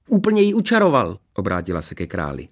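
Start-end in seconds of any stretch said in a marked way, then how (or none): 0.1-1.2 very high, gleeful telling-on; tattling princess. A narrator's reading. Princess telling on her sister to the king, tattling. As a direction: squeal the secret out.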